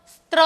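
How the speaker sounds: स्त्र stressed loud with the intruding t; wrong cluster. Shown as a demonstration. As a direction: hammer the mistaken स्त्र sound. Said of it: "इस तरह का उच्चारण सुनाई देता है". (स्त्र – ज़ोर देकर गलत 'त' वाला उच्चारण)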